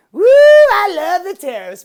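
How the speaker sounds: loud, high whooping cheer; a sarcastic victory whoop; mock cheering. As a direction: ham it up like a gleeful fan. Mocking tariff fans.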